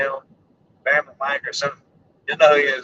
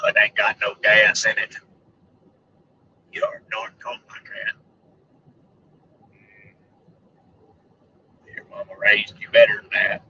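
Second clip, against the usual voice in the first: Sling Blade impression; 7 semitones lower than usual. Vlogger quoting Sling Blade, low character voice. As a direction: do the man from Sling Blade, pitched down. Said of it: "Sling blade".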